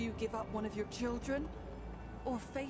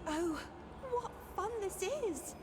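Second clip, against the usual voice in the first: delighted spirit; 17 dB quieter, slower than usual. Acted.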